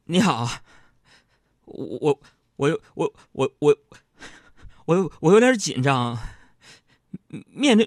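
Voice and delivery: stuck stuttering with long halting pauses; tongue-tied, stumbling over every word; nervous stammering. Retold or imitated. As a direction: stammer through a nervous confession, repeating words. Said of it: "我就磕磕巴巴的".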